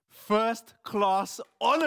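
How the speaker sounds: slow, loud proud shout; slow loud joyful call; triumphant announcement. Acted. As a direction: drag out the news with pride. Announcing the exam result.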